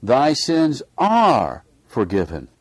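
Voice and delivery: slow, weighty "are" in "Thy sins are forgiven"; stress on ARE. Shown as a demonstration. Preacher repeats the verse, slowly stressing "are". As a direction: land squarely on "are", unhurried. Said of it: "with an emphasis".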